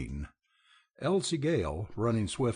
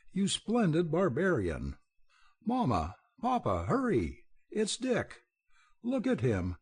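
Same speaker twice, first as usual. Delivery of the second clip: high, breathless tumble of joy; overjoyed; 7 semitones higher than usual. A narrator's reading. Elsie greeting her long-lost brother, overjoyed and high. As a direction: gush it out, nearly in tears of happiness. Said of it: "a face expressing extreme joy".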